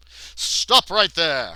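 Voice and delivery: loud, drawn-out call across a room; slow, booming challenge; commanding shout. Acted. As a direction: halt them with a theatrical yell. Calls across the room in game.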